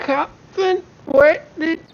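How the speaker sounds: very high, broken-up mom voice; breaking-up phone call. Acted. Mom calls her flying son, high voice breaking up. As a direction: cut in and out like a bad connection. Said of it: "the call drops".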